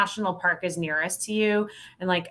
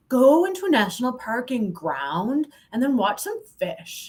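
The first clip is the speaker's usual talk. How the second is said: higher, lightly put-on voice teasing her own advice; mock preachy advice; 6 semitones higher than usual. Guest parodying her own recommendation to listeners. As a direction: poke fun at your own advice. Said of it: "I'm like".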